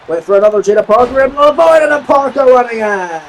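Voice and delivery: normal talking voice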